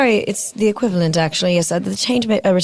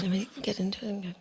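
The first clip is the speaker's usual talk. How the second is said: whispering; 15 dB quieter than usual. Real, not acted. Getting into character, quietly.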